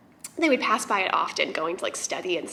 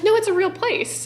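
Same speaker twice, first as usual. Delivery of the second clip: excited insistence; 10 semitones higher, 5 dB louder than usual. Retold or imitated. Friend insists, retold.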